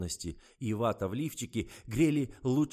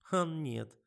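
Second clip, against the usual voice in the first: ordinary delivery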